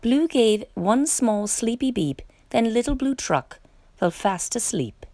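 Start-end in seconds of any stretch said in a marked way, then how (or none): none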